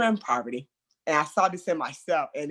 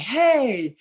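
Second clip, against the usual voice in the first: loud, higher hey; loud announcing; 6 semitones higher, 6 dB louder than usual. Shown as a demonstration. Entering a room and needing attention, loud announcing. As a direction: announce yourself over the top. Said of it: "announce it by being loud".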